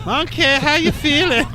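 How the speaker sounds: high, slow coo to a pet monkey; coaxing the monkey. Pretend. Mad scientist coos at his test monkey, high and slow. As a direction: sweet-talk the lab monkey, drawn out high.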